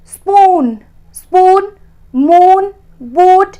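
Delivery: teacher drilling long oo words. Shown as a demonstration. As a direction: lean into the oo of स्पून and मून. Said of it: "तो बड़ा ऊ है".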